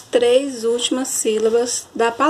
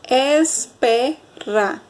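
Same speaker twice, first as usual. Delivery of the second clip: slow "espera", split into es-pe-ra; teacher sounding out "espera"; syllable by syllable; much slower than usual. Shown as a demonstration. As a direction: break "espera" into three separate beats. Demonstrating the three syllables.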